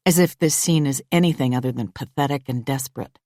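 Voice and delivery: usual speaking voice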